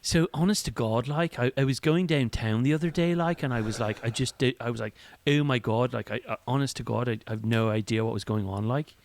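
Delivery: surfery Dublin accent; annoying Dublin accent. Shown as a demonstration. Co-host demonstrates an annoying Dublin accent. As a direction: do an annoying Dublin drone full of likes. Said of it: "an annoying Dublin accent".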